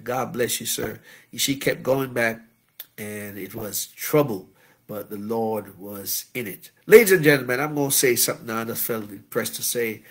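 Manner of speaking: ordinary delivery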